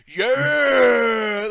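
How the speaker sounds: high, loud mock cheer; overexcited commentator shout; hyped yell. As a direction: belt out a gamer-nerd "Yeah!". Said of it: "he sounds like the angry video game nerd".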